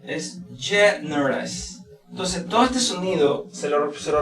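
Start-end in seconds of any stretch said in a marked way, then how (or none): 0.6-1.8 generous drawn out syllable by syllable; slow, softer generous in separate pieces; slow breakdown. Shown as a demonstration. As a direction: stretch generous so each sound is clear. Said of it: "esta pronunciación es".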